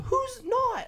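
imitated scream; high-pitched mock-furious demand; Trip. Boss challenging a busy worker, imitated screaming. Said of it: "He's screaming at the top of his lungs".

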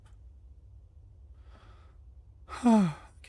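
long breathy exasperated sigh; loud exasperated sigh. Real, not acted. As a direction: let out a heavy sigh. After the zombie fight.

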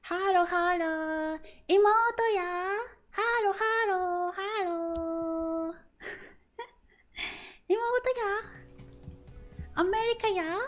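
high, squeaky hello hello in a cartoonish sing-song; mock anime girl voice. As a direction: play a cutesy anime sister greeting everyone. Greeting in a mock Japanese voice.

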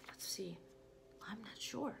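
ordinary delivery, nothing marked

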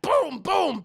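two loud, high booms; explosive mock bangs; imitated gunshots. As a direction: shout out cartoon gunfire.

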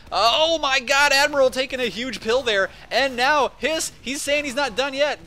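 high, loud hype as the play breaks; excited shout. Real, not acted. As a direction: explode with excitement at the big play. Big play in live cast.

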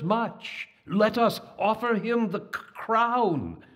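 put-on high, slow, quavering old voice with stumbles; a frail old elder stammering out his wise proposal; quavering old elephant. Oldest elephant Cornelius proposing Babar as king, high quavering old voice. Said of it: "said in his quavering voice".

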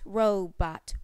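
usual speaking voice

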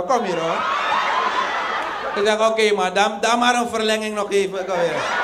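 low coaxing voice. Acted. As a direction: coax her back, low and easy.

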